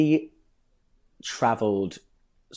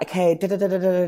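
imitated ballet teacher; 8 semitones higher, faster than usual. Imitating ballet teacher talking French.

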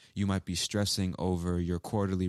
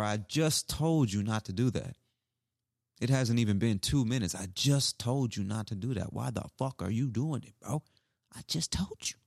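exasperated, higher scolding of a friend who ignored a warning; annoyed scolding; 4 semitones higher than usual. Shown as a demonstration. Teacher acts out scolding a friend, annoyed and higher. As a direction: snap at him for doing it after being told. Acting annoyed at someone repeating.

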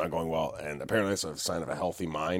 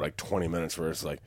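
normal talking voice

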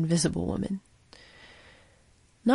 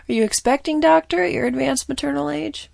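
teasing question; 8 semitones higher, 8 dB louder than usual. Acted. Teasing question to older doctor.

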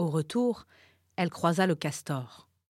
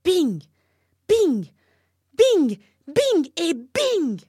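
loud, higher, punishing voice with bings; wooden man beating; 9 semitones higher, 9 dB louder than usual. A narrator's reading. Wooden man punishes the turtle, loud with each bing. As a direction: count out each blow loudly. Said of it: "lui dit-il".

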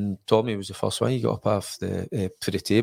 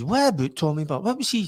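dad's puzzled question, pitched up; dad surprised, voice pitched up; imitating dad, higher; 8 semitones higher than usual. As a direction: voice the surprised father. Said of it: "he says".

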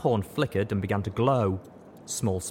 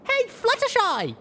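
put-on much higher, louder, slow child's call; young voice crying out; 5 dB louder, slower than usual. A narrator's reading. Filly calling out eagerly to Fluttershy. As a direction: call out across the square to her. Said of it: "a young voice cried out".

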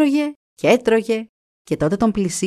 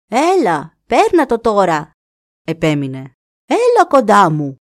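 urging raven; 3 dB louder than usual. Acted. Raven insisting the deer step on, loud. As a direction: press him to come, louder.

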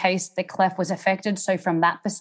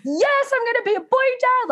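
hammed-up gleeful new father; excited husband. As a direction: imitate an overjoyed new dad, way up high. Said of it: "he was just in".

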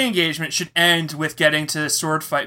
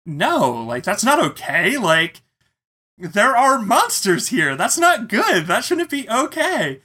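pitched up high, urging the others; alarmed protest; 9 semitones higher than usual. Retold or imitated. Worried player insisting the group search for Evan, alarmed high protest. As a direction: push back, rising in alarm.

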